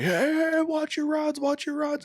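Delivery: high-pitched mock yell of warning; imitated yelling. Angler imitates other boats yelling at him, high mock holler. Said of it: "four other boats yelling at me".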